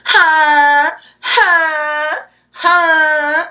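high, sharp questioning huh. Shown as a demonstration. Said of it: "I like saying".

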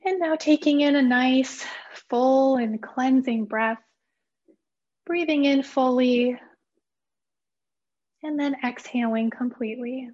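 calm, unhurried meditation guide with long pauses; guided meditation. Acted. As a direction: gently lead listeners into relaxation, phrase by phrase. Leads a guided mindfulness meditation.

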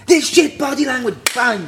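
loud, high mock tirade; imitated manager's rant. Manager attacking a player's body language, imitated angry rant.

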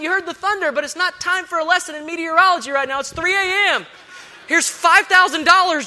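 ordinary talk

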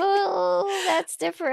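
high, sarcastic surprise; mock surprise. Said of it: "And I was like".

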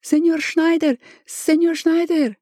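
high-pitched urgent call; a woman hailing him in a high voice. A narrator's reading. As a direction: call out for someone as you come running. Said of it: "a woman called and came running".

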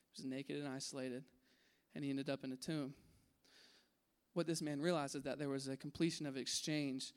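hushed, earnest sermon delivery; soft intimate preaching. Acted. Preaching the climax softly.

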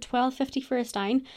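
ordinary delivery

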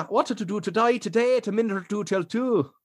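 high, lilting Irish; Irish accent. Shown as a demonstration. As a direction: rattle off an Irish warm-up phrase. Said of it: "Classic one for that particular accent".

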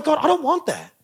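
high-pitched mock complaint to God; whining protest. Preacher retells arguing with God over a car, whining.

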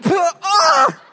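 high yelp; a hit little brother's cry; mock yelp. Little brother hit by a shoe, high yelp.

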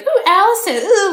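high singsong teasing coo; sibling teasing. Retold or imitated. Retelling a movie, mimics sister teasing in high singsong. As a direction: tease her like a bratty little sister. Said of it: "like brother-sister teasing".

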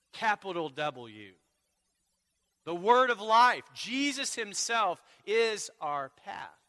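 slow, then loud and high; ringing sermon climax; preaching at full force. Acted. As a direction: proclaim the Word of life.

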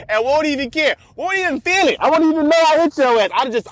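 worked-up rant at cyclists, rising high and fast; rising excited boast. Real, not acted. Boasting about hitting cyclists.

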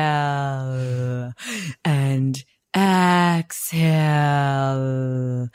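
mimicking a yoga instructor's drawn-out English accent. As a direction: draw each breath word out like a posh English yoga teacher. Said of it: "so drawn out in this English accent".

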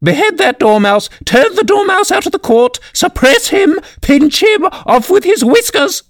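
frantic, very high-pitched royal shriek. A narrator's reading. Queen orders the dormouse thrown out, shrieking. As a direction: shriek out one order after another. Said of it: "the queen shrieked out".

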